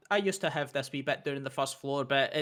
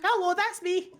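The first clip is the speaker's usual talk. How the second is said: put-on high, bright little girl voice; squeaky young shopkeeper; child voice.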